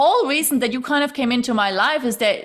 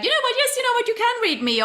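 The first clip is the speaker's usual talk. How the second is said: imitated self; 13 semitones higher than usual. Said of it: "voicing own relieved decision".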